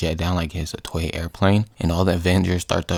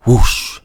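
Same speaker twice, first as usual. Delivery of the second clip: loud, raised rushing-air effect; punchy spoken whoosh; imitated whoosh; 4 semitones higher, 7 dB louder than usual. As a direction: voice air sucking someone out. Imitated sucking out.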